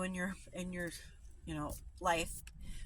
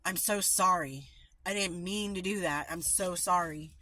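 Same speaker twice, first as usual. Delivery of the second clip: louder, harsh "I'm so sorry"; irritated loud sorry; curt apology; 5 dB louder than usual. Shown as a demonstration. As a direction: snap the apology out. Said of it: "it changes the way your words come off".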